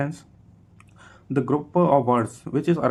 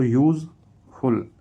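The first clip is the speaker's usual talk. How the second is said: "Useful" split into two louder, separated syllables; 5 dB louder than usual. Shown as a demonstration. Teacher showing a two-syllable word, "useful" syllable by syllable. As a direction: stress each half of "useful" with a pause between. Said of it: "टू syllable".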